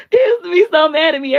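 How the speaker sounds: laughing through the words, high and loud. Real, not acted. Laughing to viewers about her stepfather. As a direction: crack up while talking. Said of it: "I'm laughing 'cause I'm thinking".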